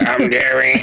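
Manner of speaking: a hint of an electrolarynx rasp, laughing; Terry voice box impression. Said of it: "She's like".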